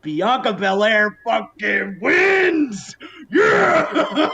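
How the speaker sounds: slow, high, loud cheering; excited shout. Real, not acted. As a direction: cheer the win at full voice. Celebrating the win.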